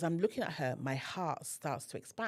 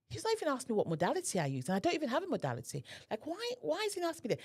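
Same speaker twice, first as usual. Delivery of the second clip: fast, higher, rushed thinking aloud; rushed inner thought; 11 semitones higher, much faster than usual. Retold or imitated. Remembering her confusion at the healer, fast and high. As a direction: rattle off baffled thoughts at speed. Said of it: "I remember thinking".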